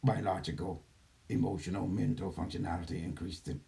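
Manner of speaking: calm computer system announcing steps; gentle care robot. Acted. Channeler switches into computer voice.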